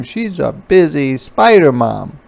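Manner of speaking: ordinary delivery, nothing marked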